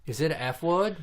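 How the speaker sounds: put-on high little-kid question; imitated curious child. Daughter checking about a bad word, high child voice. Child reacting to being corrected.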